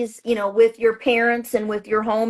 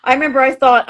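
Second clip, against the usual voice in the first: normal talking voice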